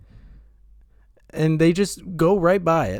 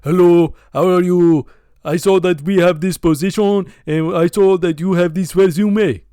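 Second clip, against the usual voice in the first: loud mock Indian telemarketer man; Indian telemarketer accent; 2 semitones higher, 7 dB louder than usual. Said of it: "stereotypical telemarketer".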